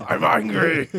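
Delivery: loud hammed-up angry line; mock angry shout. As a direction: bellow it, over the top. Mock Kratos family scene.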